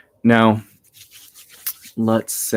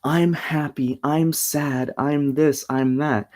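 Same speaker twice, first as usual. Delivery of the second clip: higher-pitched mock kid listing feelings; lilting, raised-pitch little-kid quote; childlike recital; 7 semitones higher, much slower than usual. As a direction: lightly imitate a child's simple statements. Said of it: "we hear it as little kids".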